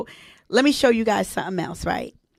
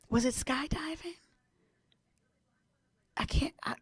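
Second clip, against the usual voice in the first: whisper to herself; 4 semitones higher, 15 dB quieter than usual. Real, not acted. Trying to remember her list.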